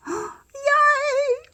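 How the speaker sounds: high yelp of joy; delighted. Real, not acted. Delighted at the petals.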